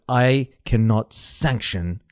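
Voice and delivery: slow, low retold rebuke; grave, measured imitation; Tommy Lee Jones. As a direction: deliver it slowly, a murmured put-down. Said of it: "like, in a whisper".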